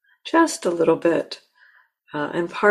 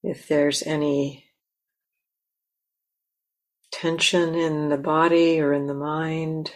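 guided meditation. Acted. Teacher guiding the listeners to land in themselves, calm and unhurried. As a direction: gently lead them into stillness.

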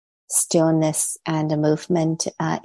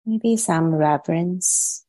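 guided meditation. Real, not acted. Teacher settling online meditators into posture and body, guided meditation. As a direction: ease them gently into stillness, leaving long pauses between phrases.

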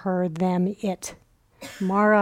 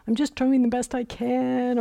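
higher-pitched plaintive protest; mock defensive whine; 5 semitones higher than usual. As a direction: plead defensively, imitating someone reactive. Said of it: "he's not reactive. He doesn't say".

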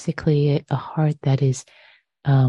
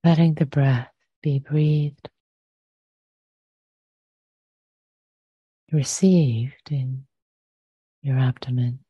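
guided meditation. Acted. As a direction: ease listeners gently into the body.

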